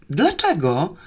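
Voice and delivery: mild mock-despair question, rising; despairing cry. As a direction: ask with a touch of desperation. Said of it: "ryknął z wyraźną rozpaczą". (lekko zrozpaczone pytanie)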